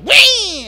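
leaps in with a high shrill yell like a scared raccoon; cornered raccoon squeal; wild high shriek. Retold or imitated. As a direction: shriek as you pounce. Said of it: "it was like".

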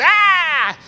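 high, loud frustrated squeal; hammed-up angry shriek; mock angry yelp. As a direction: squeal like a child who is fed up. Abba getting mad at teasing.

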